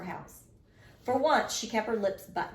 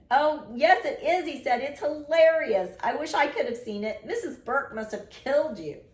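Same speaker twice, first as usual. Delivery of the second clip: high, loud delighted teasing; amused brother; 7 semitones higher, 5 dB louder than usual. A narrator's reading. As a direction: tease with glee, high and loud.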